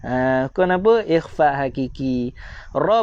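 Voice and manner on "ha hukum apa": ordinary talk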